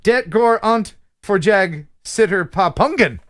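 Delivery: slow Swedish punchline in an American mouth; attempted Swedish reading. Shown as a demonstration. Host ends the viewer's limerick, slow attempted Swedish. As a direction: sound out the Swedish to a big finish. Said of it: "actually read it in Swedish".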